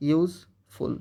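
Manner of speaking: "Useful" split into two syllables, ইউজ, pause, ফুল; slow pronunciation. Shown as a demonstration. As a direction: say "useful" slowly with a break before ফুল. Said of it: "এটাকে আস্তে আস্তে উচ্চারণ করো". ("Useful" আস্তে আস্তে, ইউজ আর ফুল-এর মাঝে থেমে)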